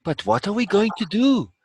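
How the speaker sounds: put-on high-pitched, earnest boy voice. A narrator's reading. Boy asking the old man for a plan, earnest. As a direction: plead the question in a high young voice. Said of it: "the boy earnestly asks".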